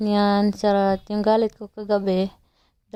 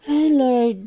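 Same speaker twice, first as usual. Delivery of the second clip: mock plea to God; 4 semitones higher than usual. Broke mom crying out to the Lord, mock pleading. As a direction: cry out to the heavens half-jokingly.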